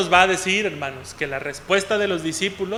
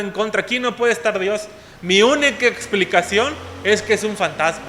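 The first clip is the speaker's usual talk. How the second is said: higher, louder panicked reasoning; frightened disciples; 4 semitones higher, 5 dB louder than usual. Retold or imitated. Disciples in the storm convincing themselves, higher panicked voice. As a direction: talk yourself into fear, higher and louder.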